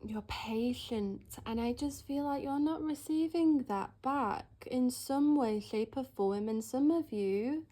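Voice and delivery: ordinary talk, nothing marked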